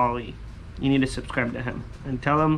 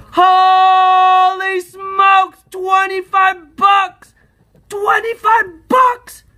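very high, loud, drawn-out yell; ecstatic shout; 9 dB louder, much slower than usual. Real, not acted. Scratcher uncovers twenty-five bucks, ecstatic shouting. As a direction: bellow it high, stretching each word.

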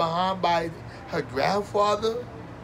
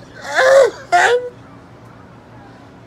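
mock wailing laugh; 10 dB louder than usual. Comedian mock-wails at greedy family.

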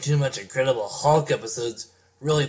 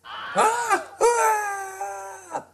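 high strained shouts of change; transformation scream; 3 dB quieter than usual. Acted. Transforming into the Hulk.

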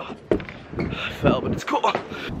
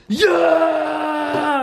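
excited shout; 10 semitones higher than usual. Real, not acted. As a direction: yell it hyped up. Hyped before basketball.

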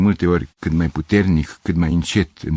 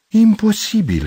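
high, loud exclamation; stunned, raised-voice denial; shocked disbelief; 5 dB louder, slower than usual. Acted. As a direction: reject the news outright. Gavrilescu refuses the news.

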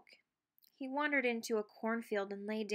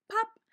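popping sound; 12 semitones higher, 6 dB louder than usual. A narrator's reading. Imitating popping.

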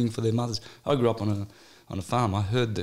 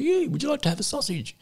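lightly mimicked salesman, pitched much higher; mimicking the prankster's cheery offer, higher; jokey salesman. Retelling a Brazil prank, mimicking the salesman's cheery offer. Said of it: "he's stopping the women and says".

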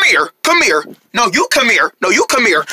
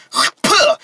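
short spit sound; spitting; 4 semitones higher than usual. Acted. Boy practicing distance spitting, spitting sound. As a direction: another quick puh of spit. Practicing how far he spits.

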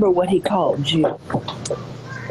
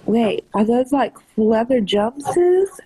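ordinary delivery

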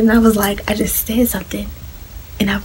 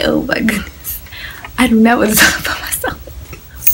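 whispered panic. Retold or imitated. Retold inner panic.

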